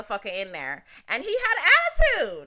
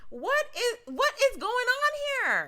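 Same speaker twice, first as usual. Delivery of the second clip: put-on confused kid; 13 semitones higher than usual. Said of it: "And I'm like".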